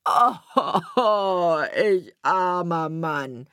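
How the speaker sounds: loud groan, slowly drawn out; wailing lament. A narrator's reading. Carter seeing his wheat eaten up, drawn-out wail. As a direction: moan it long and loud in self-pity. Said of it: "Da rief der Fuhrmann".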